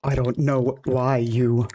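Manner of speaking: slow, drawn-out mock man voice; mock manly voice. Streamer answering a comment on her deep voice, mock manly voice. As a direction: stretch it out slowly, playing the man. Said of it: "Manly voice. Yes, I'm a man".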